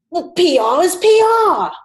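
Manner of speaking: high, loud mock-British outburst of disgust; furious British accent. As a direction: imitate an incredulous British supervisor exploding. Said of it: "He was furious".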